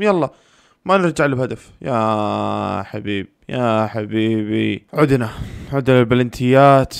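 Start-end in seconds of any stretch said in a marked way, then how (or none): 1.8-4.9 very slow, stretched-out words; drawn-out lament. Real, not acted. Fan watching the chance pass, drawn-out lament. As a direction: drag each word right out. Groans over play near the goal.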